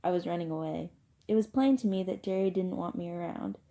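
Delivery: ordinary delivery, nothing marked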